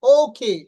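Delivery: "ওকে" loud, as ou-khey; stressed glide and airy k in "ওকে"; correct pronunciation. Shown as a demonstration. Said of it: "not ওকে". ("ওকে" জোরে, ওউ-খেই)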